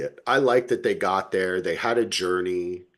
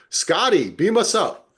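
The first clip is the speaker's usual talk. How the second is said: higher, louder hammed-up command; a hint of a captain calling the ship; mock Star Trek captain; 11 semitones higher, 5 dB louder than usual. Joking that the characters called for teleport, mock command. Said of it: "they were like".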